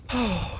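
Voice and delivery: imitated audible sigh of relief. Man imitating his date letting go of the wheel, sigh. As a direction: let out the date's relieved sigh. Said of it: "she actually sighs".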